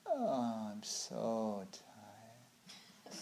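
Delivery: extremely slow, soft sleepy sigh; foggy tiredness. Acted. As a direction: stretch it out as if nodding off. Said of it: "warm and foggy, and you're just like".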